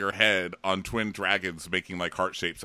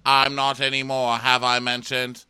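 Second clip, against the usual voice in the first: louder, deadpan announcement; Bran voice; 4 semitones higher, 8 dB louder than usual. Retold or imitated. Bran loudly declaring himself to Sam. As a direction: state it grandly. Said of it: "He's like".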